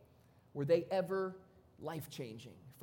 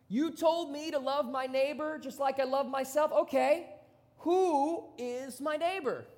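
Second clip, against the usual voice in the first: cocky challenger; 5 semitones higher, 4 dB louder than usual. Retold or imitated. So he asked Jesus.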